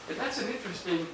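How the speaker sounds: ordinary talk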